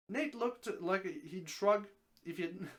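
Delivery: usual speaking voice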